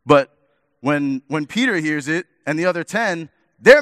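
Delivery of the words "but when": ordinary talk, nothing marked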